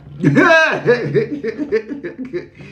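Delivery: loud, high burst of laughter; high laugh tailing into chuckles. Real, not acted. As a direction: let out a long cackling laugh. Laughing at her own confession.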